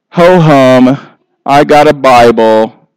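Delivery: bored churchgoer. Acted. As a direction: yawn through it like a bored believer who'd rather go fishing. Bored believer acted out.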